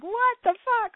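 high, exasperated plea to the heavens; pleading prayer. Retold or imitated. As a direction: cry out a desperate prayer. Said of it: "this pleading prayer slash meditation".

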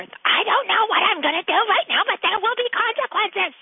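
high, loud stern threat; stern warning. Retold or imitated. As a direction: lay down the law. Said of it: "And I told her".

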